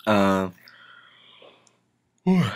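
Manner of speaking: yawning mid-sentence. Real, not acted. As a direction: talk through a yawn.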